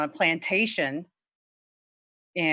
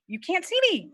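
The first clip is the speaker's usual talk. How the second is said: higher alarmed cry; 12 semitones higher than usual. Acted. Bride hides from groom.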